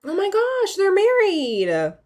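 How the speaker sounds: high, drawn-out astonished exclaim; shocked gasp. Real, not acted. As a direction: stretch it out in shock. Shocked by game wedding.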